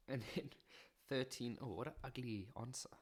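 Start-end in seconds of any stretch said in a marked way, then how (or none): none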